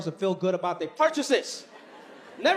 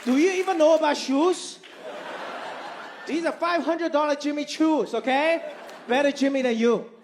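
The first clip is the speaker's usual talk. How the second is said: offended mom; 7 semitones higher than usual. Retold or imitated. Offended mom scolds son.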